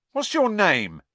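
high, loud pushy question; persistent cockney lad. A narrator's reading. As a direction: keep pressing him. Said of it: "Stan persisted".

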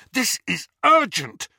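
high, loud and fierce. A narrator's reading. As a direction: insist fiercely that it's urgent. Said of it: "he said fiercely".

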